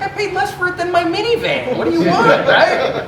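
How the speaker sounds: high, laughing comeback; laughing retort. Retold or imitated. Answering the Pharisees about his car, laughing. Joking comeback to church critics.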